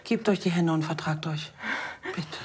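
soft whisper. Acted. Hushed plea to reconcile.

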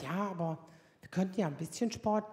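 low, soft, hesitant husband; Ralf's meek voice. Retold or imitated. Husband cautiously suggesting sport, low and soft. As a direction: suggest it timidly.

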